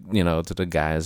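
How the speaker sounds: normal talking voice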